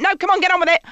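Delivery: mock impatient queuer. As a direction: hurry them along, fed up. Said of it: "Now I'm just like".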